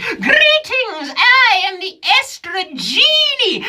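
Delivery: high, slow magical genie; grand genie voice. Acted. Genie greeting the comic who rubbed the lamp, high and grand. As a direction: announce yourself as a grand genie. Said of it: "magical being who said".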